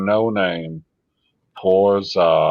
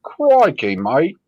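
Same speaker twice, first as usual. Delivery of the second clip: mock Australian, much higher and louder "Crikey"; a hammed-up Australian "Crikey, mate"; Australian accent; 7 semitones higher, 4 dB louder, slower than usual. Teasing a friend by faking an Australian accent. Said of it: "more Australian than Stevie".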